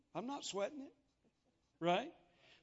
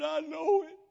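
put-on drunk man; 8 semitones higher, 11 dB louder than usual. Drunk man answering the preacher.

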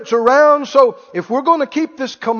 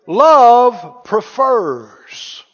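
slow emphasis; 3 dB louder, much slower than usual. Acted. Preacher drawing out key phrase.

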